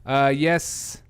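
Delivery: ordinary talk, nothing marked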